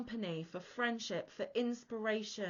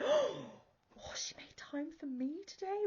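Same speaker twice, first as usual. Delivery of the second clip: breathless whisper of disbelief; awed whisper; 7 semitones higher, slower than usual. Retold or imitated. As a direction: hush it like a secret thrill. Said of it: "I'm just like, gasps".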